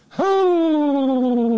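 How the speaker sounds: high, loud falsetto whoop of mock alarm. Real, not acted. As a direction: let out a shrill mock-scared hoo. Reacting to quoted outrage.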